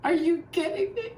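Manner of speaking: put-on high, squealing teen-girl voice; excited kids. Kids gush at Kasich's surprise appearance, high squeal. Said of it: "Kids were like".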